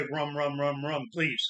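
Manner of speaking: soft, dry drink order; low-key joke, ordering rum; deadpan bar order. Retold or imitated. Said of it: "we go to the bar and say".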